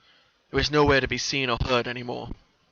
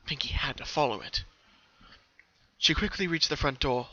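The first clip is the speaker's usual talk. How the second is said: usual speaking voice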